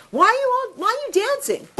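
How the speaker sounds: high, astonished demand; a guard who cannot believe what he sees; the prison guard asks the dancing rabbis, astonished; baffled guard. Retold or imitated. Puzzled guard questions dancing prisoners.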